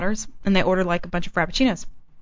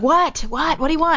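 exasperated; 7 semitones higher than usual. Retold or imitated. Retells snapping at knock on door.

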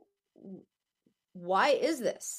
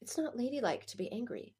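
half-whispered, softer quoted scolding; retold whisper; 7 dB quieter than usual. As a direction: hush it like a disapproving remark passed on. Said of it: "someone was just telling me".

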